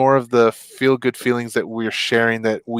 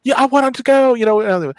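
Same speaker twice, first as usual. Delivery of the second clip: much higher, louder jealous whine; mock envious whine; 12 semitones higher, 3 dB louder than usual. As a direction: sound left out. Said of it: "I'm like".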